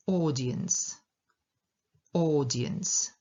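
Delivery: "audience" with a drawn-out AW at the start; long O, first-syllable stress. Shown as a demonstration. Teacher showing learners "audience", long stressed first syllable. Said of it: "Długie O".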